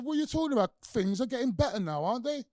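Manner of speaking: high, loud, playful insistence; loud, high-pitched mock protest. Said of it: "And I said".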